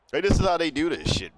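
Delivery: normal talking voice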